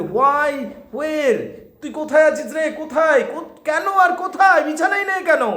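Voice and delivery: high anxious questions; alarmed she isn't in bed; worried searching. Acted. Mother finding the bed empty, alarmed.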